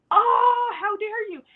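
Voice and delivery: high, loud gasped "oh" dropping in volume; mock outrage. Friend takes the boss's side, mock outrage. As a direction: act hugely affronted.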